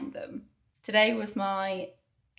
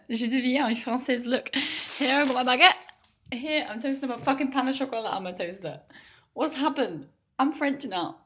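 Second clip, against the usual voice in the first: high, louder playful bragging; playful boast; 6 semitones higher, 4 dB louder than usual. Acted. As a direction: show off your French life jokingly. Playfully acting French.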